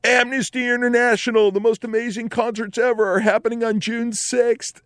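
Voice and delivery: high, hyped-up concert promo voice; radio announcer. Retold or imitated. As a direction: imitate an excited radio ad. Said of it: "turned on my radio and heard".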